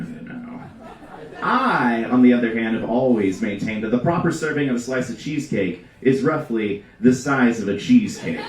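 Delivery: ordinary delivery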